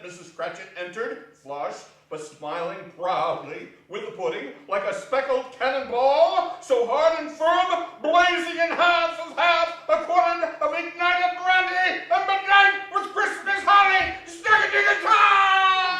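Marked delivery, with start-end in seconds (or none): 4.7-16.0 much higher, much louder, shouting; rising to a triumphant shout. A narrator's reading. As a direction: proclaim the pudding in triumph. Triumphant pudding climax.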